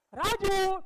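loud, high shout down an alley; calling out. Acted. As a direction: holler a name to someone in the street. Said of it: "કોઈ બૂમ પાડે ને". (ગલીમાં મોટેથી બૂમ પાડીને)

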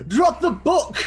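over-the-top yell breaking into laughter; hammed-up yell. Player's character yelling mid-charge, shrill-high playful shout. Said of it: "I yell out".

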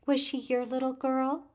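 put-on a little girl pressing gently but stubbornly; soft, stubborn child. A narrator's reading. Felicia quietly pressing the woman about the girl, stubborn child voice. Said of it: "said Felicia softly, stubbornly".

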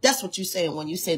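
ordinary talk, nothing marked

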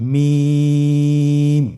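মিম with the vowel held very long and thin; slow held recitation of মিম; long drawn vowel. Shown as a demonstration. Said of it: "তিন আলিফ টেনে উচ্চারণ করতে হবে".

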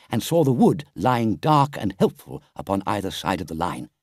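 usual speaking voice

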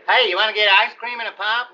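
mock innocent teen heartthrob pitch; wholesome kid voice. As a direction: play the cute baby-faced kid asking you out for ice cream. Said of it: "like somebody that should be saying".

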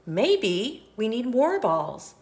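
ordinary delivery, nothing marked

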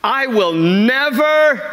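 swagger through it like a cocky Peter; cocky Peter boast. Retold or imitated. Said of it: "this is a loose translation".

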